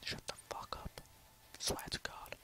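unvoiced-soft, whispering under the breath; whispered curse. Real, not acted. Player grumbling to himself at the game, whispered.

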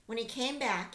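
usual speaking voice

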